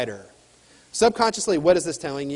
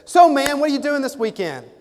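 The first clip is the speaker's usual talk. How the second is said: chatty salesman; 8 semitones higher, 4 dB louder than usual. Retold or imitated. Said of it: "he says".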